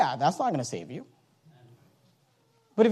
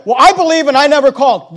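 loud, higher imitated objection; mock objector's protest; 7 semitones higher, 13 dB louder than usual. Preacher imitating people who say they never called, loud mock protest. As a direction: throw out the objection loudly, mimicking a protester.

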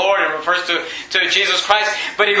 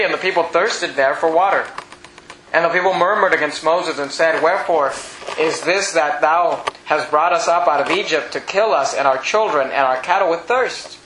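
lower, measured King James reading voice; scripture reading; 5 semitones lower than usual. Acted. Preacher reading Exodus to the congregation, low and measured. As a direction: read the old verse with gravity, pitched low.

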